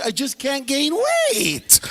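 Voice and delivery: high, slow, stretched-out complaint; mock whining. Imitating skinny dudes who can't gain weight, mock whining. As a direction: drag out the last word in a hammed-up whine.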